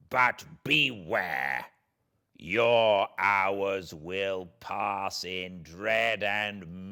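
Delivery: the wronged creature, bitter and threatening, slow on the warnings; vengeful creature. Acted. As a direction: speak as a spurned monster promising revenge. Creature threatens his maker.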